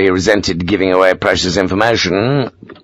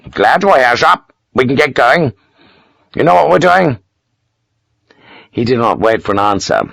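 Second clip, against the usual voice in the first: brisk commanding Major; 6 semitones higher than usual. Acted. Major briefing Sharp on the march, brisk and higher. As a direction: rattle off the plan and demand agreement. Kersey gives orders.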